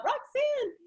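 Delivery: high, drawn-out call of her name. Real, not acted. Host calling out to guest Roxanne in the audience, a bright high call. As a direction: sing out her name across the room.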